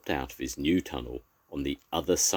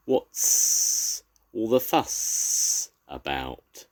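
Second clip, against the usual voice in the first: slow drawn-out hissing adder; snake voice; much slower than usual. A narrator's reading. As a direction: stretch it lazily like a snake. Snake character speaks.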